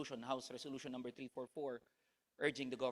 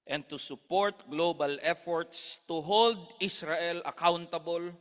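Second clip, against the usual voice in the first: fiery revolutionary speech; 8 dB louder than usual. Acted. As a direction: declaim it like a rally address. Solidarity speech to a rally.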